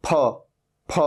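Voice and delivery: फ as aspirated "phuh" from closed lips; isolated aspirated p, said twice; PH sound. Shown as a demonstration. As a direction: join both lips, then release फ. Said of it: "दोनों होठों को मिला लो और फिर बोलो".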